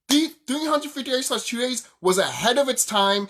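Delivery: ordinary talk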